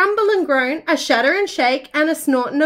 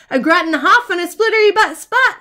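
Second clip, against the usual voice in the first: ordinary delivery, nothing marked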